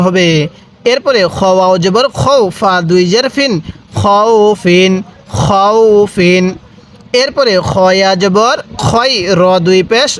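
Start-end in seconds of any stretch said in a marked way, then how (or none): 4.0-6.4 slow, drawn-out "খৌফিন" with a distinct final n; clear tanween. Shown as a demonstration. Teacher drilling tanween for learners, "খৌফিন" slow and clear. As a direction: stretch "খৌফিন" evenly, sound the closing n. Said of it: "তানভিনটা আমরা স্পষ্ট করে পড়ব".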